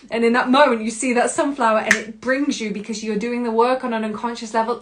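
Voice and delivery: ordinary delivery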